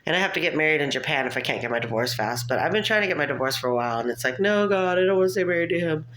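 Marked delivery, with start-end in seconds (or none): 4.4-6.0 high, louder, hurried comic plea; mock pleading. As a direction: plead in playful desperation. Acted plea about her divorce.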